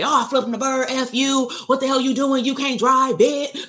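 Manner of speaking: high-pitched indignant driver rant; mock road-rage outburst. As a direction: rattle off insults like an outraged motorist. Acting out road-rage reaction.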